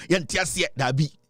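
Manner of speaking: ordinary talk, nothing marked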